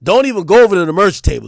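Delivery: ordinary talk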